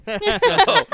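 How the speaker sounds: high, loud laughing burst. Real, not acted.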